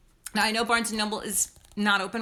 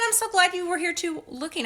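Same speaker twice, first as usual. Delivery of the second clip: normal talking voice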